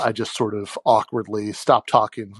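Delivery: normal talking voice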